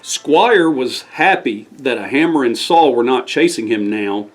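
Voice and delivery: ordinary delivery, nothing marked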